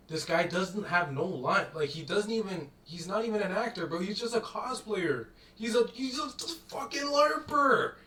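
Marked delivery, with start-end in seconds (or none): 5.6-7.9 exasperated outcry. Real, not acted. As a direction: draw the insult out in a raised, high voice. Climax of rant against the actor.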